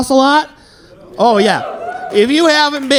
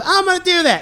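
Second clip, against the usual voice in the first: high mock waitress; 9 semitones higher than usual. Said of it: "She goes".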